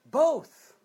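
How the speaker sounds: loud, high one-word burst; punchy high exclamation; emphatic exclamation. Real, not acted. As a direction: blurt the answer out high and loud. Emphatic answer to own question.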